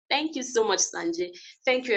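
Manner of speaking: ordinary delivery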